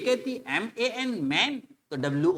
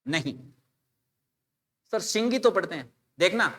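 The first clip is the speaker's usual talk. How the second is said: ordinary talk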